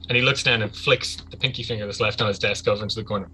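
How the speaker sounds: ordinary talk, nothing marked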